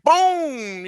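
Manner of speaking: long high-pitched "boom". Retold or imitated. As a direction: boom it out like sudden blaring speakers. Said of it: "it was just on regular volume".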